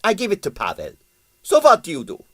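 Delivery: defiant impresario. A narrator's reading. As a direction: dare her to respond. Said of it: "said Strogonoff defiantly".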